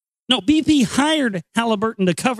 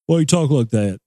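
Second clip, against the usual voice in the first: hillbilly local; 5 semitones lower than usual. Retold or imitated. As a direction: sneer it like a backwoods local. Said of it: "hillbilly, podunk places".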